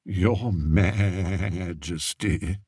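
mock him softly like a sheep; soft sheep mimicry. Courtier mocking the shepherd to the king, soft bleating imitation. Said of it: "imitated him softly, making the old shepherd sound like a sheep".

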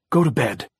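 loud, angry-sounding command; crying out. A narrator's reading. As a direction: fear coming out as anger. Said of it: "she cried".

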